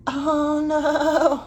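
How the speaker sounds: high, imitated British sympathy; British accent. Friend reacting to the broken howlite, mock British accent. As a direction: put on a high British lilt. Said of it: "She said it in a British accent".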